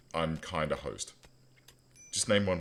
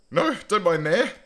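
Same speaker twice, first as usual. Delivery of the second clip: higher, louder panicked no; alarmed shout; 11 semitones higher, 8 dB louder than usual. Real, not acted. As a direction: shout at the miner to stop. Panic at miner digging.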